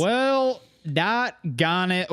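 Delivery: high loud slow doggone; playful frustrated outburst; exasperated. Real, not acted. Dismay at stolen pick.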